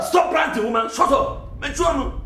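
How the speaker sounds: sharp, high-pitched outburst; angry silencing. Acted. Man ordering the ranting woman to shut up, angrily. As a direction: cut the rant off with a high, irritated order.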